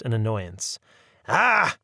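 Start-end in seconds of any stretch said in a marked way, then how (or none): 1.3-1.8 high, loud scornful repeat; old woman mocking the law's name; disgusted mocking. A narrator's reading. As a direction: spit the name back in contempt. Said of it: "she repeated; noise of disgust".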